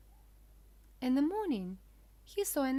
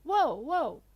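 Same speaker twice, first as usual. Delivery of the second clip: louder, high-pitched alarm call; loud cry; 5 semitones higher, 9 dB louder than usual. A narrator's reading. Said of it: "cried Pinocchio".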